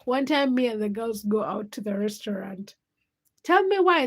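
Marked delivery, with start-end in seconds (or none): 3.4-4.1 excited build-up. Real, not acted. Building to the punchline.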